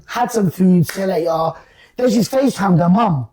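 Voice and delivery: ordinary talk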